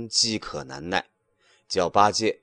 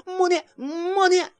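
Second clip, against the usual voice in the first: high, frantic monkey cry, much higher; pleading cry. A narrator's reading. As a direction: beg him to stop, over and over. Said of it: "一叠声的叫". (尖声连连哀求)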